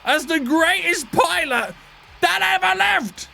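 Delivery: high, slow, shouted gloating boast; sneering wrestling villain. Acted. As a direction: crow it like a wrestling heel. Boasting after the landing.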